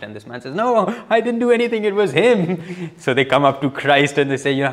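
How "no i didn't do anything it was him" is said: high, hurried and defensive; mock-panicked denial with a laugh; blame-shifting healed man. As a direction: squeak it out, pointing the finger.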